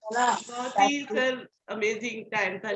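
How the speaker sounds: ordinary talk, nothing marked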